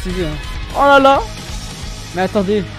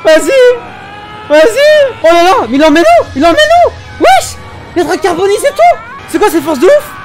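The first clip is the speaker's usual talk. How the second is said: loud, high excited yelling; hyped shouting; 10 semitones higher, 5 dB louder than usual. Real, not acted. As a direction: cheer it on at the top of your voice. Streamer hyped, shouting at fight.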